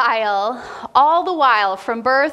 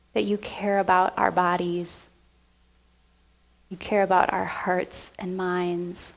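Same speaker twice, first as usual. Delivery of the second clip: prayer. Real, not acted. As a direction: pray gently to Jesus. Pastor leads closing prayer.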